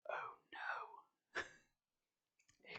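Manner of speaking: breathless whispered dismay; tiny whispered gasp of dread; horrified whisper. A narrator's reading. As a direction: whisper it in dawning horror. Dismay at his clothing.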